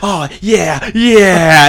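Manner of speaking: loud, drawn-out creature yell; creepy mock gloating. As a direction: act out a loud, lecherous cheer. Creature assaulting Morty, acted out.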